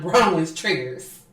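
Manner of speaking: normal talking voice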